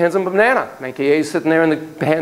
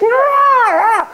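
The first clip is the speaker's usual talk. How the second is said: high shrieking ape cry; monkey shriek; 5 dB louder than usual. Acted. Monkey B shrieking at the researcher, high shriek.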